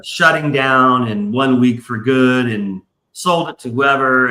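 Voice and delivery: usual speaking voice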